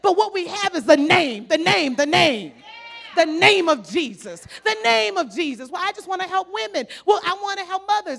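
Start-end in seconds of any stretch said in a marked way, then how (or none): 0.0-5.7 loud, slow, emphatic preaching on the name; preaching at full force. Acted. Preacher to congregation, loud emphatic preaching. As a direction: drive the name home to the congregation.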